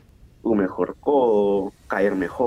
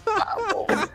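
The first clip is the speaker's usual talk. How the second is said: hype cry; 4 dB quieter than usual. Retold or imitated. Cheering the crowd.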